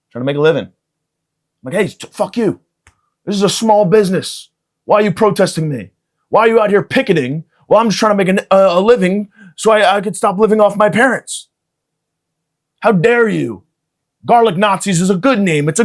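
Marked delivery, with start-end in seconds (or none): 1.7-13.6 high, loud, outraged small-business owner; a wronged shopkeeper yelling at picketers; indignant shop owner. Pretend. Shopkeeper yelling at the protesters, high and indignant.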